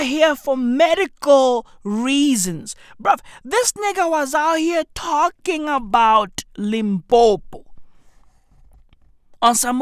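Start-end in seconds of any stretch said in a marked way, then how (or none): none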